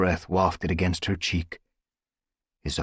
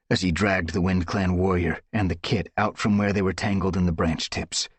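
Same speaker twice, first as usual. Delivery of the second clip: usual speaking voice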